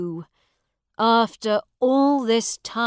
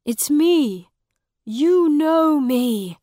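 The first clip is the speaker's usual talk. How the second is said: high, loud, drawn-out insistence; urgent, impassioned cry; desperate plea; 7 semitones higher, 12 dB louder, slower than usual. A narrator's reading. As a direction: beg to be recognised, voice raised. Miles pleads to be recognised.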